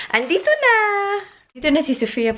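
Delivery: excited. Real, not acted. Excited reveal with laugh.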